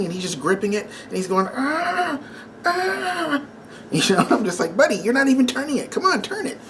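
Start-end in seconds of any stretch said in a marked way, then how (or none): 1.5-3.4 high, strained mock whine of a struggling kid; a kid's high whimpers of effort; imitated whimper. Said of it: "he's bawling like a baby". 4.8-6.6 urging. Retold or imitated. Dad urging son to open bottle.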